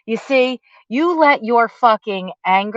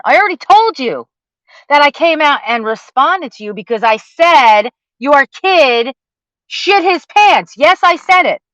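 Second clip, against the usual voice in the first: rising anger; 5 semitones higher, 4 dB louder than usual. Real, not acted. Angry rant at Justin.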